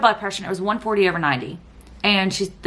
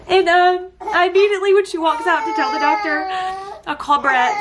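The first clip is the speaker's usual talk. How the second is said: tearful; 8 semitones higher than usual. Real, not acted. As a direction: push through the words while crying. Still crying, calling husband.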